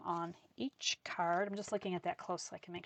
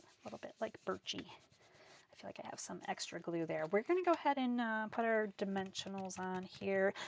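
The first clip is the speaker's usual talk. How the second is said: ordinary talk, nothing marked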